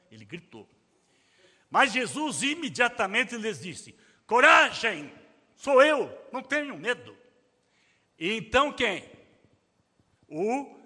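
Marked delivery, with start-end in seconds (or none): 4.3-7.2 a touch of a commanding call. Acted. Jesus calms the frightened disciples.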